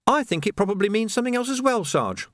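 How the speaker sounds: sniggering. A narrator's reading.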